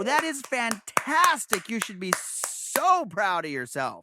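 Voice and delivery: loud, high, stretched-out praise; excited congratulations. Real, not acted. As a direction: gush slowly with pride, leaning on each key word. Congratulating listener on weight loss.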